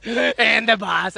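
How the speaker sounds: slow, drawn-out In the bus; stress on bus. Shown as a demonstration. Girlfriend repeating her point, slow drawn-out laughing emphasis. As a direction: stretch "In the bus" slowly with a laugh.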